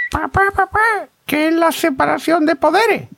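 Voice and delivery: put-on very high-pitched child voice; niño preguntón. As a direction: pipe up like a little kid asking dad. Niño pregunta a su padre en un chiste. (voz muy aguda de niño)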